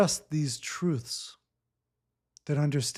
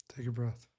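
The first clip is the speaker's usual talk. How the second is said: much softer, lower, gentle cue; soft breathing cue; 6 semitones lower, 11 dB quieter, faster than usual. Real, not acted. Coach guiding a client into a breath, soft and low. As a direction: drop the voice to a soft invitation.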